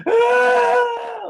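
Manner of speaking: put-on high screaming toddler wail; loud bawling wail. Shown as a demonstration. Teacher demonstrating a toddler bawling, loud wail. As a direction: let out a huge bawling cry. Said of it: "you're going like".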